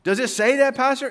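high, loud challenging question; challenging questioner. Acted. Congregant challenging the pastor on a verse, insistent questioning.

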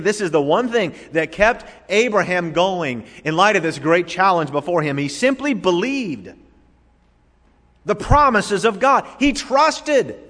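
high, driving sermon delivery; insistent pulpit proclamation; fervent preaching. Acted. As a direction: build emphatically to a high peak. Preaches at raised intensity.

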